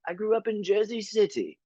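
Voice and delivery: put-on high, proper English-accented voice; prim British cashier. Mimicking the cashier naming Jersey City. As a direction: answer primly. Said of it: "super proper".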